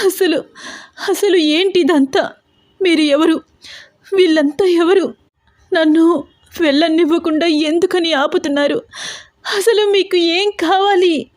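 high tearful, slowed questions; scared girl shouting at her captors; frightened crying shout. A narrator's reading. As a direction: cry out in terror, voice high. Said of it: "అని గట్టి గట్టిగా అరుస్తోంది హారిక".

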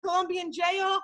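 high, frantic plea from a mother begging for help; pleading wildly for her jailed son; panicked mother. Retold or imitated. Mother begging the airman for help, frantic and pitched high. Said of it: "she was like".

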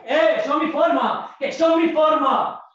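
loud, high, drawn-out shout; angry shouting. Acted. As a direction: shout it in self-defence. Acted quarrel, insisting loudly.

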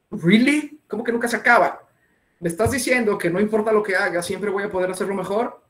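playfully incredulous questioning; mock incredulity. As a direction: act out a baffled comeback. Acts out his disbelieving reply.

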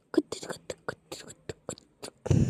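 soft, unvoiced stuttering clicks; voiceless clicking noise. Real, not acted. Reacting wordlessly to the screen, soft voiceless clicking. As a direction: make tiny clicking sounds under your breath.

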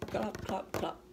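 usual speaking voice

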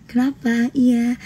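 lightly put-on girly softness; a hint of sweet, demure girl. Streamer mocking her calm-girl self for viewers, lightly girly. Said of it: "itu cewek banget".

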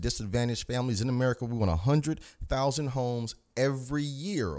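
ordinary talk

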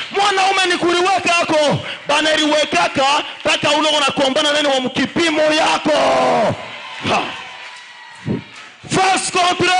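loud, driving sermon delivery, French and Swahili; fiery preaching. Acted. Preacher rousing the congregation to rise, shouting. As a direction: preach it to the crowd at full force.

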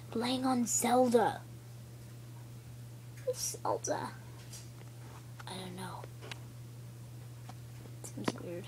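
hushed, breathy secret whisper. Pretend. Toy whispering to a friend about Zelda, in a whisper. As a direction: whisper it so nobody hears.

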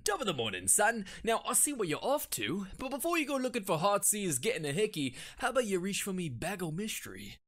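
hammed-up Cockney-ish accent from a big man; leering London dock bloke, lightly accented; mustachioed Englishman. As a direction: lean into a leering Cockney geezer. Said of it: "a large mustachioed man".

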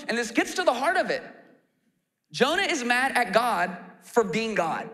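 ordinary delivery

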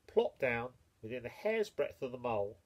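ordinary delivery, nothing marked

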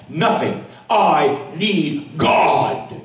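drawn-out, very slow plea; slowly pleading "I need God". Acted. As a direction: stretch every word of "I need God" out slowly. Preacher repeats his plea.

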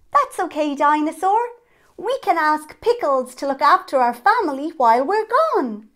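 high, loud, cheerful comforting; upbeat reassurance. Acted. Child reassuring Dinosaur about the family, upbeat.

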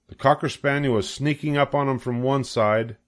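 ordinary delivery, nothing marked

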